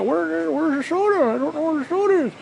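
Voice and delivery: a high, slowed-down, bewildered Dale T; clueless friend. Retold or imitated. Quoting Dale T, confused.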